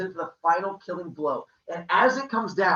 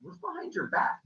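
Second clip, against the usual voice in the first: high suspicious question; 10 semitones higher, 5 dB quieter than usual. Acted. Father suspicious.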